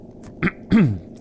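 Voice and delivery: short rough throat clear. Real, not acted. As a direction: clear your throat with a cold. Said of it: "Sorry, I've got a cold at the moment".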